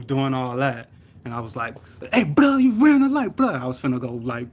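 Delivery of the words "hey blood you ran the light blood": high, loud outraged shout; retold angry yell. Injured driver to the man who hit him, mad yelling. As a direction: yell it as an accusation at the other driver. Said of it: "I was, like, yelling".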